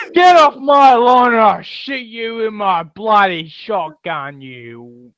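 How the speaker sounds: high, loud hammed-up Southern threat; mock-angry Southern landowner hollering; Southern accent. Shown as a demonstration. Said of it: "Wait, I can do that".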